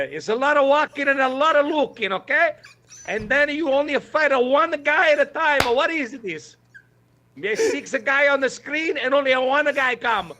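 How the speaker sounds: high, hammed-up Italian accent. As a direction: ham up a thick Italian accent. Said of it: "Say that with your Italian accent".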